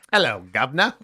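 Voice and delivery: loud, hammy British greeting; badly faked British accent; put-on British accent. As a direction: do a cheeky mock-British hello.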